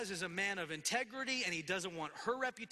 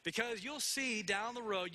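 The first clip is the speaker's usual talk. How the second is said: ordinary talk, nothing marked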